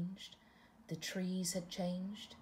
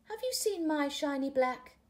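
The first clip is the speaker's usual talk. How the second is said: higher and louder, eager question; hopeful butterfly; 8 semitones higher, 6 dB louder than usual. Acted. Butterfly to frog, searching for her love, higher and louder.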